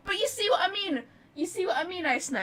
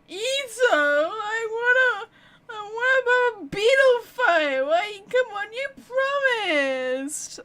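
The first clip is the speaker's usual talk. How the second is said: drunk kid impression; 9 semitones higher than usual. Streamer mocking the game kid's voice, drunken whining. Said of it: "He sounds drunk".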